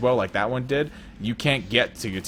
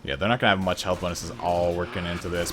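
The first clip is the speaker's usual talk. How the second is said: ordinary talk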